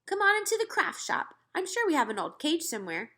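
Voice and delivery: high, loud, brisk aunt; sweeping them along; eager invitation. A narrator's reading. Aunt inviting the boy into the shop, eager.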